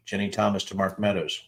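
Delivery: ordinary delivery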